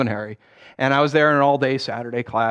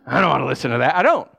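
normal talking voice

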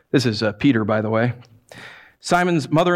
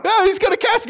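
very high shouted panic; panicked minion. Pretend. Minion fearing Jesus will cast him out, high panic. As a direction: squeal in terror.

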